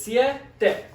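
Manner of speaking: siete split as "sie", pause, "te"; two separate syllables. Shown as a demonstration. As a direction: say siete slowly in two parts. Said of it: "So two syllables".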